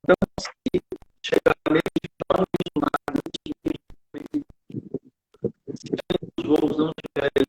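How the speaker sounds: breaking up over a bad internet call; choppy call audio. Real, not acted. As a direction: voice dropping in and out on a weak connection. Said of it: "tá cortando só".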